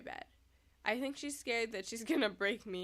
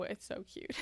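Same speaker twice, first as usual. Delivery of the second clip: whispered; 6 dB quieter than usual. Real, not acted. Whispered aside about the cat.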